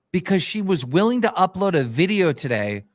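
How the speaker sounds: ordinary talk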